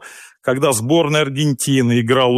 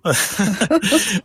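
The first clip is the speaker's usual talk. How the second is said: laugh; 6 semitones higher than usual. Real, not acted. Guest reacts to host's remark, amused high chuckle.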